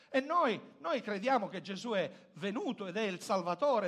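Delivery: usual speaking voice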